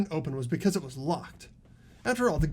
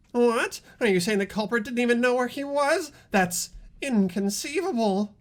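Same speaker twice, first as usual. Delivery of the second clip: surprised; 6 semitones higher, 4 dB louder than usual. Acted. Shocked reaction in debate.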